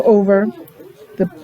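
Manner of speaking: ordinary delivery, nothing marked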